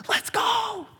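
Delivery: hyped friend cheering your news; excited cheer. Retold or imitated. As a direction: cheer it out like a fan. Celebrator voiced.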